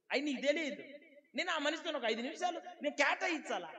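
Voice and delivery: high, loud preaching with bursts of laughter; fiery preaching. Acted. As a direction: preach it at full force to the congregation. Pastor preaching at full force.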